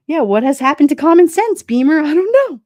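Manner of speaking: loud exasperated disbelief; excited outburst. Real, not acted. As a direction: throw your hands up at the question. Reacting excitedly to a chat comment.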